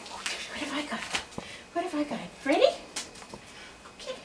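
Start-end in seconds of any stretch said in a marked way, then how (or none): 0.1-1.0 whispering. Real, not acted. Teasing dog with toy.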